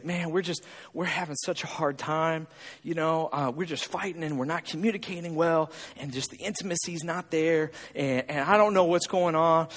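low, soft imitated confiding; struggling couple. Couple confiding marriage troubles to their pastor, low and soft. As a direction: mildly act a weary marriage confession. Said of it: "they'll say".

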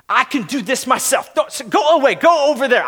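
mock-indignant child pushing help away; imitating defiant child. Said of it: "the child who goes".

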